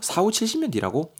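high-pitched incredulous question; acted disbelief. B reacts to hearing the copyright term, acted disbelief. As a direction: echo it back in mock astonishment.

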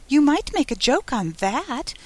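high, tiny voice at her ear; little voice. A narrator's reading. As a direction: pipe it up like a very small creature. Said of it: "said the little voice".

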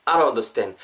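"I don't" slurred into "a-ron"; flapped "don't". Shown as a demonstration. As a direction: tap an r in place of the d in "don't". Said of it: "우리말의 리을로 발음된다라고 생각하시면".

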